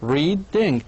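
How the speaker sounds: "Reading" split into rea, ding; short stop inside "Reading", between rea and ding; syllable break. Shown as a demonstration. Teacher answers how many syllables, breaking the word in two. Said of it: "ما بين كل مقطع وآخر وقف قصير".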